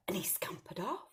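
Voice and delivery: soft secret whisper; narrated whisper. A narrator's reading. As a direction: hush the line like a secret.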